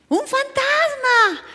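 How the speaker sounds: very high, slow, drawn-out shriek of fright; acting out the scared fishermen; terrified cry. Disciples on the boat seeing Jesus, a high frightened shriek.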